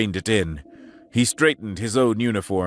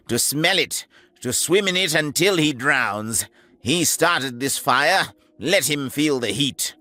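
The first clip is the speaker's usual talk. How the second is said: higher, cutting orders with bitter relish; the major; 4 semitones higher than usual. Acted. Major ordering the captain, bitter and hard. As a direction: make him suffer for it.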